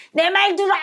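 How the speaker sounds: high, loud command; demanding "listen to me" yell; stubborn shout. Shown as a demonstration. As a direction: bark it like forcing the world to obey. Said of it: "막 이렇게 하는 거야".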